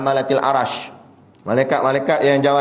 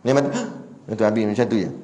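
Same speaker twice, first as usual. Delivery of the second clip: dying gasp; 5 semitones lower, 4 dB quieter, much faster than usual. Shown as a demonstration. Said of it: "demonstrates dying".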